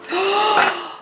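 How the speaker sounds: high, shocked gasp; mock gasp. Soap maker reacting to teasing, mock-shocked gasp. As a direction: gasp as if scandalised.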